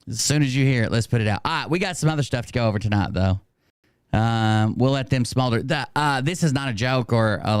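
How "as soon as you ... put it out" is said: ordinary talk, nothing marked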